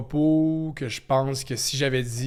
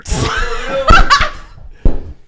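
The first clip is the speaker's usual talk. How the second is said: shrieking laugh; 15 dB louder than usual. Real, not acted. Burst of laughter.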